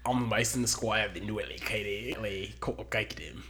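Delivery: Australian intro tried over; retry the name in a mock Australian accent; a clumsy Australian accent; bad Australian accent. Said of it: "The worst Australian accent ever".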